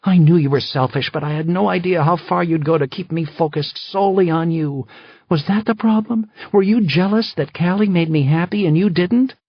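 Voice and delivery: high, loud furious accusations; fury. Acted. Wife furious at her husband, high and loud. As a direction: hurl every question at him in rage. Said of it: "Her face was twisted in fury".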